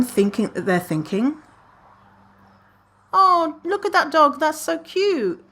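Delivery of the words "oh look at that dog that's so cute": high cooing voice for others' thoughts; high sweet imagined thought; imagined sweet passerby. Retold or imitated. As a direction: coo it like a charmed stranger.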